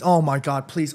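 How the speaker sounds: ordinary delivery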